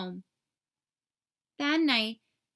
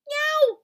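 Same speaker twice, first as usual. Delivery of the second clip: a sudden high, loud yelp; high yelp of pain; 8 dB louder than usual. Acted. Burglar falling into a trap yelps high and loud. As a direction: yelp as you hit the trap.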